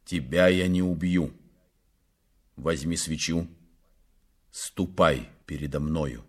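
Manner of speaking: low, cool command; icy, controlled, low-pitched; cold. A narrator's reading. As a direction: order coldly in a low voice. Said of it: "сказал он холодно".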